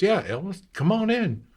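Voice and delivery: put-on high, eager old shopkeeper voice; shop owner voice. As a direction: play the welcoming merchant.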